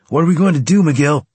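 high, loud, out-of-breath shout. Acted. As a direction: call out mid-battle, winded. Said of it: "Carlos shouted from nearby, breathing hard".

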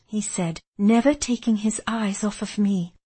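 plain reading voice

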